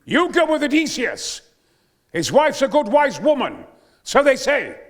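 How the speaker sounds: full-voiced Greek herald; booming declamation. Shown as a demonstration. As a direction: boom the line like a herald to the rafters. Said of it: "Say it again".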